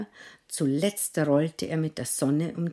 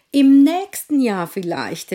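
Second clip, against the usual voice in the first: hoch und laut gerufen; rufend; 8 semitones higher, 11 dB louder than usual. Acted. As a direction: keck hinausrufen. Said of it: "rief der Pfannkuchen". (keck gerufen)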